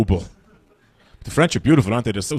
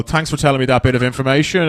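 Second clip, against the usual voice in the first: ordinary talk, nothing marked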